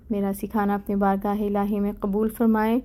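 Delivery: ordinary delivery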